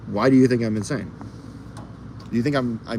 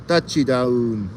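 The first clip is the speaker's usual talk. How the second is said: normal talking voice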